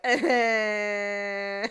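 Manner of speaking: long drawn-out "ehhh"; imitating a stressed captain's drawn-out groan; imitated long groan. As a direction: hold a mock groan on one level note. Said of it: "And Cragen's like".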